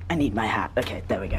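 hushed rushed whisper. Real, not acted. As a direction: whisper it so she won't hear. Hushed before call.

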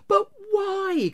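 very high, loud appeal; baffled cry. A narrator's reading. Nephew refused by his uncle, baffled cry. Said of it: "cried Scrooge's nephew".

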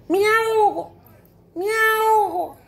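high drawn-out kitten meows; crying kitten. Acted. Storyteller as the kitten in the river, crying out high. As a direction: wail like a drowning kitten. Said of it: "Y empieza a gritar".

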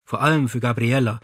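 ordinary delivery

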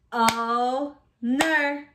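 "Owner" split as o, ner with a gap; chopped into syllables. Shown as a demonstration. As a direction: chop "Owner" into two slow beats. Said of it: "chop it out".

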